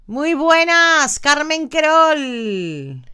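slow sing-song hello; drawn-out greeting. Acted. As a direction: stretch the greeting warmly. (saludo alargado y cantarín)

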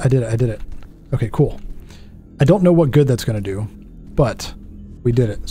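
ordinary talk

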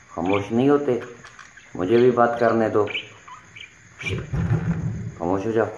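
ordinary delivery

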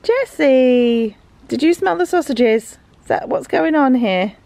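ordinary talk, nothing marked

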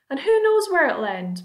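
ordinary delivery, nothing marked

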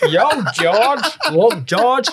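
high, loud hammed-up Yankee twang; mock American accent. Host teasing George by mimicking the American VC in a mock American accent. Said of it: "I was just picking up".

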